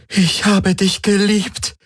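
high, slow, hurt lament. A narrator's reading. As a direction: reproach a loved one in grief. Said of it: "klagte sie".

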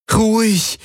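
high, pleading plea to calm. Acted. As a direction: beg the animal to calm. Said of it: "flehte Kirai ihn an".

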